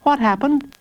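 put-on high-pitched little boy asking; small, high child voice; child's voice. A narrator's reading. Jacob questions the guards after the scare, high child voice.

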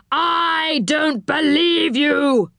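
high, very drawn-out angry disbelief; enchanter crying out. A narrator's reading. Enchanter rejects Rick's excuse, crying out angrily. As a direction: stretch each word in outraged disbelief. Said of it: "cried the enchanter".

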